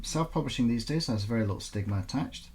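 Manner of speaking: ordinary delivery, nothing marked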